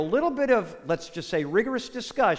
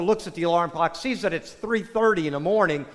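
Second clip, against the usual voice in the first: normal talking voice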